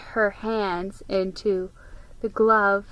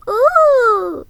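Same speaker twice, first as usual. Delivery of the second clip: very high, loud delighted ooh; thrilled child's ooh; little boy's ooh; 10 dB louder than usual. Acted. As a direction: wonder at the gift box. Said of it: "said Brad".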